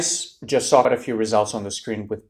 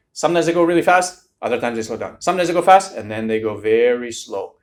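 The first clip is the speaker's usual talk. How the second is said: uneven speed demo; 6 dB louder than usual. Shown as a demonstration. Tutor showing students an uneven speaking rhythm, lurching pace. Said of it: "They're kind of like a roller coaster".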